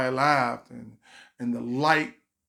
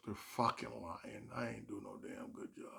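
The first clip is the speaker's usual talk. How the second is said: muttered doubt; 3 semitones lower, 14 dB quieter than usual. Retold or imitated. Comic doubting the praise, bitter whisper. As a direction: whisper it to yourself. Saying to himself.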